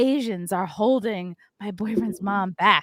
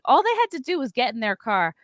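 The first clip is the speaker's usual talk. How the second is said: ordinary delivery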